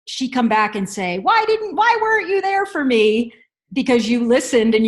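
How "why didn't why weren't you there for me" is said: put-on high, plaintive little-girl voice; whiny child voice. Mom imitating her daughter's reproach, high and plaintive. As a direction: voice the hurt daughter asking why mom wasn't there.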